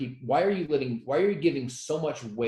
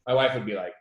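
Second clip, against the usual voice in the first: normal talking voice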